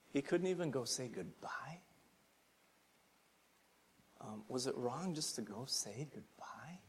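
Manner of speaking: hushed, breathless whisper of disbelief. Retold or imitated. People shocked at Jesus, whispering their objection.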